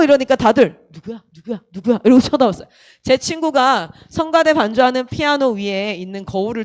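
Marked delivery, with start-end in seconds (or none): 0.9-2.0 hushed, low crowd murmur; soft, quick asides; murmured wondering. Retold or imitated. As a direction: mimic people quietly asking each other. Congregation murmuring as they turn round.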